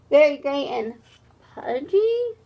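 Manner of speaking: wailing. Acted. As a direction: whimper it back like a wounded hippo. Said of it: "wailed Fredgedy".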